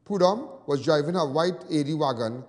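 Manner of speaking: loud, formal news report voice; news read. Acted. Reading the accident report to viewers, news read. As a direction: read it like a crime bulletin.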